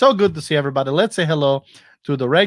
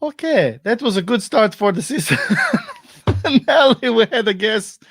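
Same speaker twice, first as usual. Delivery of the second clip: higher, laughing through the words; laughing, pitched up; 8 semitones higher than usual. Real, not acted. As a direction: laugh at the failed start. Laughing after guest vanished.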